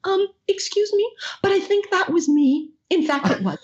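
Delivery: high, polite interjection from the crowd; timid audience girl. Retold or imitated. Girl raising her hand at a convention, timid interjection. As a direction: pipe up in a small high voice, then ease down. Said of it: "this girl puts up her hand. She goes".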